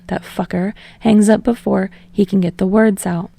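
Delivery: ordinary delivery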